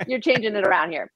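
ordinary talk

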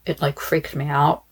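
ordinary delivery

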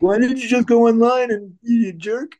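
high, drawn-out whine of outrage; mock angry complaint. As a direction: imitate an aggrieved fan, pitched right up. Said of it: "so people are mad at me".

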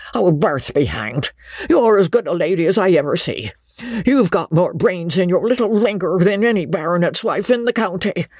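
put-on high, excited old squire; insistent character voice, ending in a high demand; urgent proposing baronet. A narrator's reading. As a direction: press the proposal hard, pitch climbing. Baronet urges his marriage proposal.

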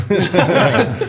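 loud laugh. Real, not acted. Laughs at own vulgar joke.

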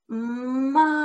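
put-on teacher modelling Mom as the child reads; reading slowly. Shown as a demonstration. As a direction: model Mom while tracking the word. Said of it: "and say".

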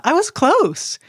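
normal talking voice

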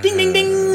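very high, ringing ding-ding-ding; imitated bell dings. Host caps the Republican spin remark, imitated bell dings. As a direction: mimic a right-answer bell up high.

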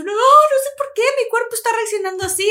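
high, loud, desperate words through tears; panicked crying reply. Retold or imitated. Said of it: "Y yo". (respuesta desesperada, aguda y fuerte)

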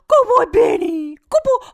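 very high, loud hooting dog-praise voice; exaggerated imitation of a doting dog dad; Missus Doubtfire. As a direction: whoop it out in a falsetto. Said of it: "he sounds like Missus Doubtfire".

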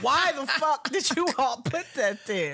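high, incredulous rant; mock-outraged complaint; exasperated outrage. Quoting own outrage at dubbed words.

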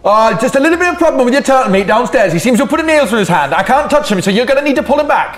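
Barry calmly reporting the mishap; Barry's voice. Acted. Barry telling Sword about the accident, in a high voice.

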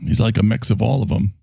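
ordinary talk, nothing marked

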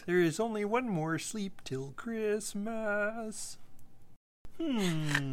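high slow puppet voice; high, slow cartoon puppet voice. Muppet voice after impressions.

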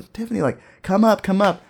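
high, eager mock call. Man urging his girlfriend up the waterfall, calling out. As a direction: urge someone up from afar, without yelling. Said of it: "I'm calling up from the".

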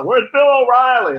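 high, loud and eager mock fan voice; imitating a pushy fan asking for Shep; excited rally fan. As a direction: play an overexcited rally goer. Fans demanding Fox hosts.

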